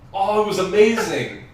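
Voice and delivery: usual speaking voice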